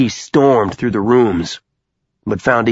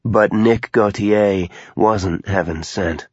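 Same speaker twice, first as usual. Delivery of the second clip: usual speaking voice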